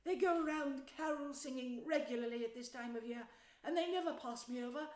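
mildly pleased, reminiscent storytelling voice; touch of pride. A narrator's reading. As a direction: let a little pride into the mole's recollection. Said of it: "with a touch of pride in his manner".

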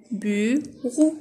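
"Bureau" as two separate syllables; split into syllables. Shown as a demonstration. Teacher repeating the division for pupils, "Bureau" split in two. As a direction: leave a gap between "bu" and "reau" in "Bureau". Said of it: "Je découpe les mots en syllabes".

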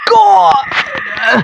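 high startled shout; jump-scare scream. Real, not acted. Player hit by a jump scare, startled scream. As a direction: yell out in sudden fright.

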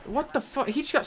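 high, shocked cry; outraged disbelief. Real, not acted. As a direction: squeal it out in real outrage. Larson lunges below him.

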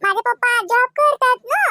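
put-on high child voice with questioning lilt; inquisitive little niece. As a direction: quiz uncle like a curious child.

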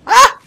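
short, high, loud cry of alarm; real startled "ah"; startled yelp. Real, not acted. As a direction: yelp as if caught off guard. Startled by enemy fire.

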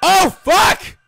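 high, startled swearing; alarmed yell. Real, not acted. Player jolted by the attack, alarmed high yell. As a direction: yelp it out in a jolt of fright.

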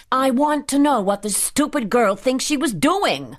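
high, indignant demand; angry scolding. A narrator's reading. Furious employer berating the cook.

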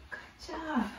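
soft gasped good job; a breathy delighted hush; whispered praise. Real, not acted. Teacher to children, whispered praise.

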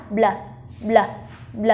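"Bl" run together as one short burst; quickly blended. Shown as a demonstration. Teacher speeding up the cluster for the child, blended sounds. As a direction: blend b straight into l for "bl". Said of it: "Nhanh hơn nào".

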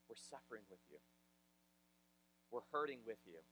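soft, low, compassionate voice; tender condolence. Retold or imitated. Someone comes up to encourage you, tender condolence. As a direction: offer it gently, quietly.

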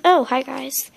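ordinary talk, nothing marked

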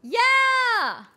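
high loud happy yell; excited cheer. Real, not acted. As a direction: cheer with delight. Celebrating finished setup.

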